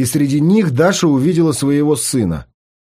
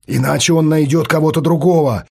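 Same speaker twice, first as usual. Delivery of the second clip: plain reading voice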